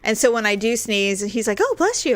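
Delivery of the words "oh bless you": high, put-on politeness; imitated well-wishing husband. Husband responds to his wife's sneeze, imitated in high voice. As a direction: lift the pitch right up for a quoted courtesy.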